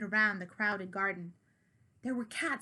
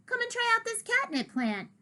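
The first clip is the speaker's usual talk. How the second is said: high calling voice; yelling; 12 semitones higher, 3 dB louder than usual. Acted. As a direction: call out across the garden. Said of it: "yelled a small tortoiseshell cat".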